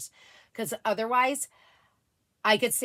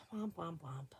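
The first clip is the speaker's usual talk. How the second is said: mock sad trombone; 4 semitones lower, 17 dB quieter, slower than usual. Real, not acted.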